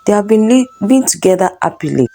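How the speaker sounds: ordinary delivery, nothing marked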